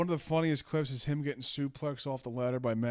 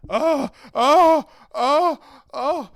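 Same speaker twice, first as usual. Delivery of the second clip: loud, high-pitched "oh" cries, one after another; mock-hurt wailing yelps; imitated wrestler's yelps; 11 semitones higher, 12 dB louder, much slower than usual. Acting out Ric Flair getting cornered, loud yelping impression. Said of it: "He started going".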